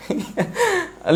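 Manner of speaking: short soft high laugh; brief quiet giggle after a slip. Real, not acted. Laughs after saying sorry.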